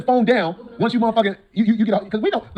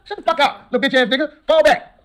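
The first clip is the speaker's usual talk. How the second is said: higher, louder taunt; angry taunt; 6 semitones higher, 3 dB louder than usual. Acted. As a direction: bark it at the critic. Taunting the critic.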